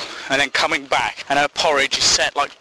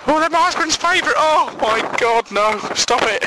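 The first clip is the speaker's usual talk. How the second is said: high, hammed-up horrified wail; mock horror in a high voice; distraught widow; 12 semitones higher than usual. Widow sees husband's favourite cakes, high hammed-up horror.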